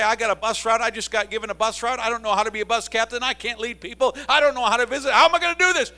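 rising to a frantic high-pitched shout; panicked new bus captain. Retold or imitated. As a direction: pile on the panic line by line until you're yelling. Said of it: "You say".

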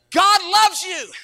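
mock sweet. Preacher ending his threat with a sugary blessing. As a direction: sweeten it to smooth over the threat. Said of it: "if you say that at the end, it makes it all okay".

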